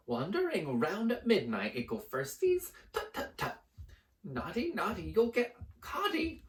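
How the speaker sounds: high, sing-song mocking tease; teasing poltergeist. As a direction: taunt them like a gleeful ghost. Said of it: "Peeves cackled".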